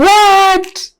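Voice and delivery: high, loud squeal of disbelief with a laugh; shocked disbelief. Retold or imitated. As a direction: gasp out the words as if you can't believe it. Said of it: "I'm like".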